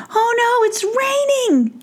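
high, panicked squeal. Acted. Giroux panicking at the rain, high squeal. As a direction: cry out in alarm as rain falls. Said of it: "said Giroux in a panic".

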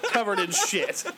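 ordinary delivery

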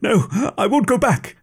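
panicked cry pitched high; struggling scholar crying out in desperation; desperate shout. Acted. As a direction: scholar refusing to be handed over, high frantic shout. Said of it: "he shouted".

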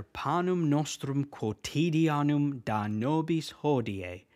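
whole line "Panem nostrum quotidianum da nobis hodie" slow and linked; teacher reciting the full Latin line; Latin pronunciation. Shown as a demonstration. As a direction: put the Latin words together smoothly, slowly. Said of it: "So when we say it together".

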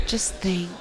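breathy whisper. Shown as a demonstration. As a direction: whisper it softly. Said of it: "change that voice".

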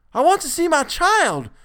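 very high, loud desperate demand; near shriek. A narrator's reading. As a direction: shriek it on the verge of collapse. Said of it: "Mister Button almost shrieked".

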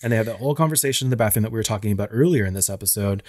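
ordinary delivery

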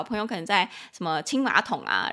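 normal talking voice

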